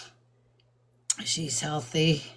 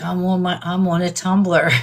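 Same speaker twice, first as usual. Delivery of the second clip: louder, excited quote with a laugh after; excited grandson; 6 dB louder than usual. Retold or imitated. Grandmother quoting her thrilled grandson to viewers, excited. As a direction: imitate an excited grandson, voice up in volume. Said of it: "he's so excited".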